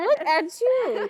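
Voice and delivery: high, stretched-out delighted praise; gushing parent. Retold or imitated. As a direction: coo admiringly at your little one, acted out. Retells gushing at her daughter.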